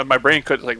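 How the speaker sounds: ordinary talk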